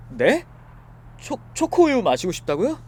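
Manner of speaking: can't believe she wants chocolate milk; 초코우유 lifted high in disbelief; baffled. Acted. Baffled by drunk request.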